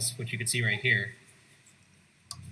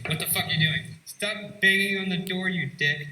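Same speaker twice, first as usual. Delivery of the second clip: irritated, raised voice, pitched up; annoyed outburst; 8 semitones higher, 3 dB louder than usual. Real, not acted. Prisoner in solitary to the friend banging on the door, annoyed. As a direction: snap back loudly at the banging. Shut in cell, yelling at banging.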